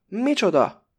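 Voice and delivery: sudden high, loud outburst; flaring up. A narrator's reading. Pakó flares up at the Greek, high and loud. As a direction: Micsoda flung out in shock. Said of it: "fortyan fel Pakó".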